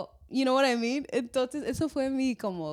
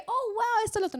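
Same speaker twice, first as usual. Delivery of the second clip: higher, louder gushing at the door; gushing welcome; 11 semitones higher, 4 dB louder than usual. Retold or imitated. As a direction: burst into the house full of wonder. Entrada efusiva a casa hispana. (exclamación efusiva, más aguda y fuerte)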